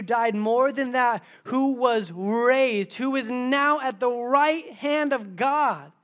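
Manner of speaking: loud, high, slow preaching of scripture; preaching at full force. Acted. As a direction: proclaim it to the whole room, building.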